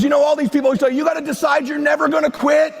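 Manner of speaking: ordinary delivery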